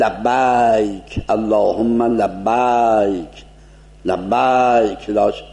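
لبیک with a wide open a, slow and drawn; wide-open Iranian fatha. Shown as a demonstration. Teacher shows the wrong لبیک with a wide a.

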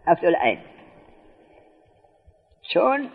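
usual speaking voice

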